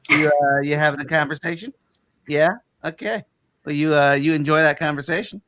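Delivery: a hint of a gentle, chatty Bruce; Bruce from Family Guy. Veteran voices the bug chatting as it leaves, like Bruce. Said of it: "Why do you sound like Bruce from Family Guy".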